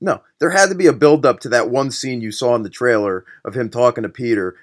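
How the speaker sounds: ordinary talk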